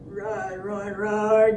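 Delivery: soft, low dinosaur rumble; quiet roaring. Acted. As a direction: roar low under your breath. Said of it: "gonna be lots of roaring".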